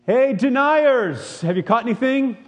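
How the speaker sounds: mock accusing shout from the shore; mocking jeer. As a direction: drag out the taunt like calling out a traitor. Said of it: "something else that we would like to call them".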